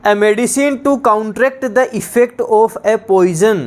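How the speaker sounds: very slow, deliberate English definition; slow dictation. Shown as a demonstration. Teacher dictating a definition to the class, very slow. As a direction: read it slowly for students to note down. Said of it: "reads the definition".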